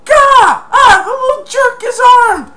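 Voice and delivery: very high, loud yelp of pain; pained burglar yell. Retold or imitated. Burglar hit by a trap, high pained yell. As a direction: cry out as if hit.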